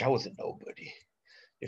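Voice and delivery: soft confessional whisper. Real, not acted. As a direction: breathe it out like a secret. Repeats his low point, hushed.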